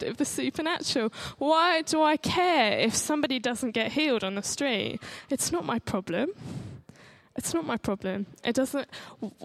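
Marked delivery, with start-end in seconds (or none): none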